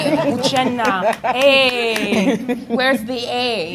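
mock-scold like a Nigerian parent. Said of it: "I have Nigerian parents".

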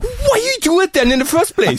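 high-pitched incredulous protest; mock exasperation. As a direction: scold a tagalong rider in comic disbelief. Acted scolding of a tagalong rider.

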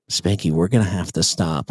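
low, uneasy announcement; imitated backseat passenger. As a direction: drop the voice way down for her. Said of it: "here's what I hear in the back seat".

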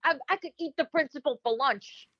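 lightly voiced swaggering boy; a hint of a smug little boy; cocky kid voice. Boy Timmy scoffing at teacher Karen, cocky.

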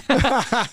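quick high-pitched chuckle; brief high real laugh; short high laugh. Real, not acted. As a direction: laugh at your own point. Laughing at own remark.

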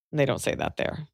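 ordinary talk

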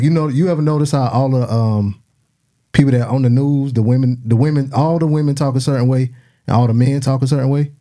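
ordinary delivery

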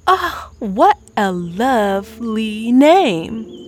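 slow, charmed and sweet. Acted. Chef charmed by the girl's name, slow and sweet. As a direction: savour the compliment. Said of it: "Venti was charmed".

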